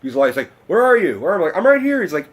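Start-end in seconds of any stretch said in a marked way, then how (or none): 0.7-1.6 raised voice searching for someone; calling out. Retold or imitated. Host calls up to someone on the balcony, calling out. 1.6-2.0 high, loud reply to be found; calling out. Retold or imitated. Fan answers host from the balcony, calling out high.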